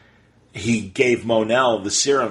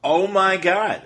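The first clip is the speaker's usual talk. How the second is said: higher, drawn-out mock horror; playfully appalled, pitched up; mock outrage; 7 semitones higher, slower than usual. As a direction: slowly gasp out pretend scandal. Mock outrage at Legion pairing.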